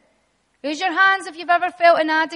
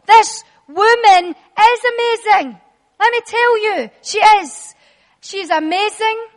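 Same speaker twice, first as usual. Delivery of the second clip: slow, raised, declamatory preaching; emphatic preaching; 3 semitones higher, 5 dB louder than usual. Real, not acted. Preacher telling congregation this woman is amazing, proclaimed emphatically. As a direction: proclaim each quality with a punched, drawn-out key word.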